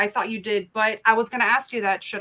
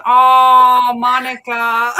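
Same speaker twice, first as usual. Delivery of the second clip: drawn-out, very loud cry of protest; loud exclamation; 3 semitones higher, 12 dB louder, much slower than usual. Real, not acted. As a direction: call out the name in loud, playful dismay. Exclaims loudly over viewer's teasing comment.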